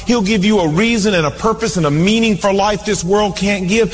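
ordinary delivery

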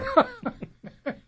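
high burst of real laughter; loud laughter, helpless at his own joke; loud, high, genuine laugh; big real laugh. Real, not acted.

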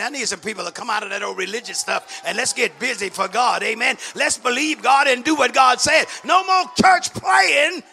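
loud, rising church exhortation; fired-up preaching. Real, not acted. Preacher urging people to get busy for God, fired-up preaching. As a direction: build to a shout and rally the church. Preacher rallies the congregation at full force.

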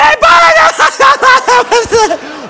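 high, loud garbled cackle; imitated hysterical laughter swallowing the words; breathless laughing fit. Girlfriend trying to speak through laughter, breathless hysterics. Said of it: "she can't breathe".

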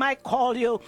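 usual speaking voice

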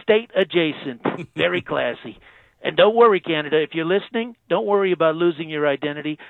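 channel a bragging president on the phone; Trump impression. President phoning into radio show, Trump-style boast.

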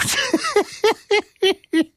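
shrieking high giggle; high cackling laugh. Real, not acted. Host cracking up mid take, high laugh. Breaks up during outtake.

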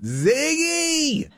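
high, delighted call of a name; excited cry. Real, not acted. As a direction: sing out the cat's name happily. Calling the cat's name.

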